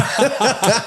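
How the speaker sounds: a real high laugh; high burst of laughter. Real, not acted. Laughing at the book title mix-up.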